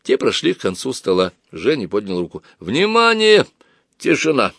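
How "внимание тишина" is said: high, sustained call for attention; призыв к тишине. A narrator's reading. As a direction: call the room to order, pitch up. (громкий призыв к тишине)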